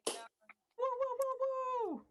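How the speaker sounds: high whooping cheer; host hypes the crowd as the comic leaves, whooping. Real, not acted.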